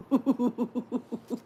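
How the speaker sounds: bright giggle breaking into words; real giggle of delight. Real, not acted. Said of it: "sorry, I giggled".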